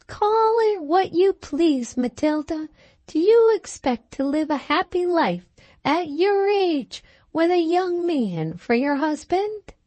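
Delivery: high-pitched, challenging lady friend; disapproving friend. A narrator's reading. Friend doubting the bride's happy life, high and challenging. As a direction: press the question in a high, objecting voice.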